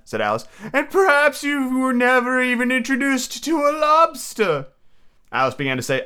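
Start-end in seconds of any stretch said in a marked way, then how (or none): 0.7-4.7 high-pitched, earnest storybook turtle; mock turtle. Mock turtle telling Alice about sea life, earnestly.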